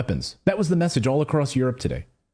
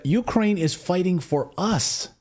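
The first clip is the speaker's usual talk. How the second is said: imitating emphasis; 6 semitones higher, slower than usual. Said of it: "Ursula von der Leyen said".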